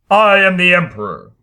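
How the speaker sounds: loud, commanding proclamation; crying out. Acted. Harrison declaring himself Emperor to the cowering crowd, loud. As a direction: proclaim it to the whole studio. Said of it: "cried Harrison".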